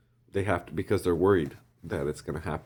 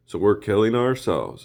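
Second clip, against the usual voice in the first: louder, rising mock outrage; indignant retort; 5 semitones higher, 9 dB louder than usual. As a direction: throw the accusation back, louder. Mocking retort, repeated louder.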